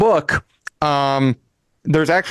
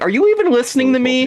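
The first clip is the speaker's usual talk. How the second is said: high fast scolding, louder; exasperated; 10 semitones higher, 3 dB louder, faster than usual. Retold or imitated. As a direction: scold him for not listening. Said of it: "she's like".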